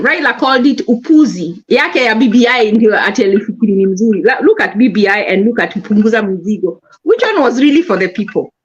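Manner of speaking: ordinary talk, nothing marked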